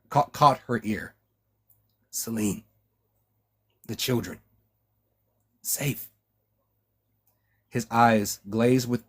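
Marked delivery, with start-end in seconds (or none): 2.1-6.1 much softer, fading, barely voiced last word; a dying man's last breathy words; dying rasping whisper. A narrator's reading. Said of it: "his voice a rasping whisper".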